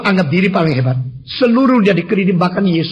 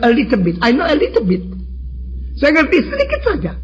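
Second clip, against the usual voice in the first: mock modesty; 8 semitones higher than usual. Feigned modest answer to challenger.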